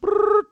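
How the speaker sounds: high, loud trilled "brrr"; vocal ringtone; mimicked phone ring. Imitates phone ring before call.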